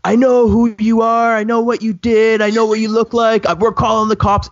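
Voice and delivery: screaming at the thief. Retold or imitated. Retelling to friends how he screamed at the drone thief, furious yelling. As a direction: roar it up at the apartment windows like you mean it. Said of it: "I just start screaming at the top of my lungs".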